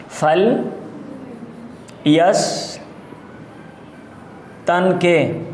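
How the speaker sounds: فل یستنقے in drawn-out pieces with very long pauses; slow dictation. Shown as a demonstration. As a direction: dictate فل یستنقے syllable group by syllable group. Teacher dictates the word in pieces.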